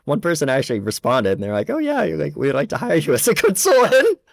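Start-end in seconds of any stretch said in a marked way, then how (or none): none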